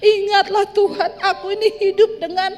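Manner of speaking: mock weeping sinner. Preacher mocks a cheating husband crying to God, in a whiny pleading voice. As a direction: whine like an unfaithful husband begging God. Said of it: "engkau nangis".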